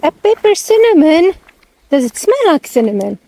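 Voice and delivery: puzzled delight at the sign; surprised question. Real, not acted. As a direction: say it like you can't believe the name. Surprised at an odd plant name.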